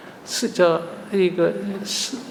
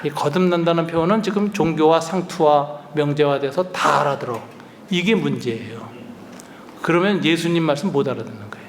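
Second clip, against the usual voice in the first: ordinary talk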